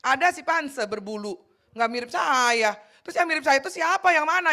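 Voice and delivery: a bewildered Adam searching for his match; puzzled Adam. Retold or imitated. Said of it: "Adam lihat gini kan".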